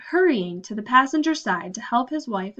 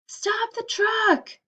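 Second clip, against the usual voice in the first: shouting for help; 12 semitones higher, much slower than usual. Acted. Pounding on the trailer wall.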